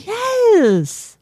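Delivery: long, high squealed cheer; gleeful triumph. Real, not acted. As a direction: stretch it out high, then let it fall. Celebrates partner missing the twist.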